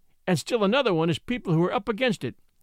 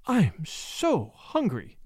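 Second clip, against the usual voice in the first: slow, weary sigh; much slower than usual. Acted. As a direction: let the hunger sag out slowly. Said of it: "sighed Cecilia".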